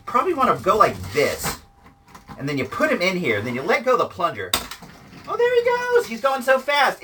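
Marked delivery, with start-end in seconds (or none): 5.3-7.0 much higher, thrilled as the toy shoots off; excited cry. Real, not acted. Cheering the toy as it zooms away. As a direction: squeal with delight at the launch.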